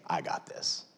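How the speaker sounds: quiet unvoiced reassurance; soft divine assurance; whispered. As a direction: whisper it calmly. Voicing God softly.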